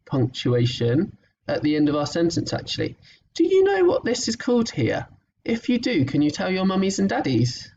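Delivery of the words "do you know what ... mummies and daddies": excited question. Acted. Teacher asks children a question.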